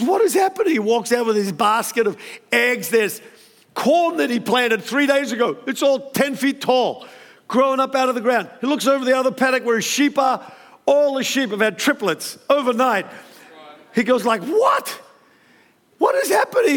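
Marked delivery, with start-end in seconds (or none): 0.0-0.7 much higher, stunned and amazed; can't believe what he's seeing; disbelief. Retold or imitated. Obed-Edom at the egg pile, much higher stunned disbelief. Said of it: "He can't believe it". 14.4-16.7 much higher astonished yell; astonished shout. Retold or imitated. Obed-Edom at his paddock, much higher astonished shout.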